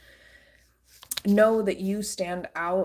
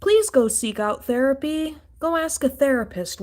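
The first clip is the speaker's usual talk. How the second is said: angry outburst; 6 semitones higher, 5 dB louder than usual. Real, not acted.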